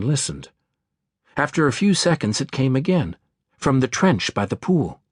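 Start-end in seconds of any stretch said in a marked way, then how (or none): none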